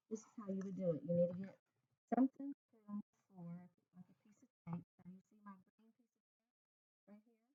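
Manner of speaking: distant, faint off-mic voice; talking from across the room; far from the mic. Real, not acted. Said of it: "I'm gonna have to be in front of the computer, or you're not gonna be able to hear me".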